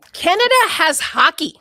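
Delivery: usual speaking voice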